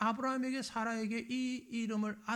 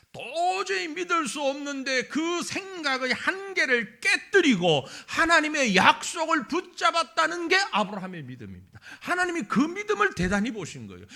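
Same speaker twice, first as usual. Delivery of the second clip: louder, driving sermon delivery; full-force preaching; 5 semitones higher, 7 dB louder than usual. Real, not acted. Pastor challenging the congregation, preaching loudly. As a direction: rouse the congregation.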